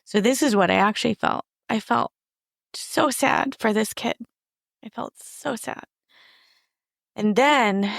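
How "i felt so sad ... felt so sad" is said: holding back real tears; on the verge of tears. Real, not acted. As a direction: voice rising, close to crying. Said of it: "oh, I'm gonna cry".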